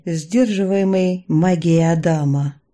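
ordinary delivery, nothing marked

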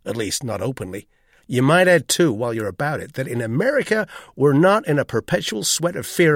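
loud, indignant American tirade; sharp-tongued, emphatic setting straight; acerbic. A narrator's reading. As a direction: reel off the complaints with tart sarcasm. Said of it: "he said with some acerbity".

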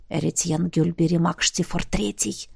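low, hushed prompting; feed him the name under your breath; whispered prompt. A narrator's reading. Roderick prompting the necromancer with a name, whispered. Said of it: "шёпотом подсказал Родерик".

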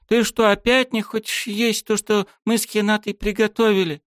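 put-on high, pouty, offended girl's voice; hurt girl. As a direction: sulk like a wounded girl. Miyami's voice in narration.

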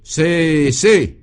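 high, drawn-out drill bawl; bawled command. A narrator's reading. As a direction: bawl it out like a brigadier. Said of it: "brailla Bognamiel". (commandement braillé)

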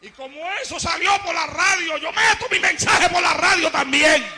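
loud, hammering sermon; preaching at full force. Acted. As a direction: proclaim it to the whole hall.

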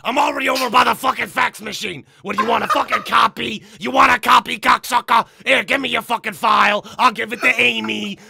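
high, shouted, irate office worker; big pussy voice. Acted. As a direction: bellow like a foul-mouthed, fed-up coworker. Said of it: "do it in the big pussy voice".

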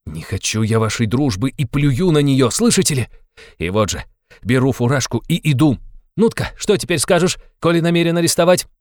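tense refusal rising to high challenge; agitated defiance, climbing in pitch; enraged, defiant suspect. Acted. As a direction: spit out the refusal, then dare him.

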